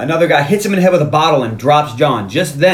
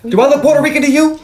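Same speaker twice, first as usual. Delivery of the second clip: high, incredulous mock challenge; indignant retort; 12 semitones higher, 4 dB louder, faster than usual. Zeus challenging the name mistake, indignant retort. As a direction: throw the question back, voice pitched way up.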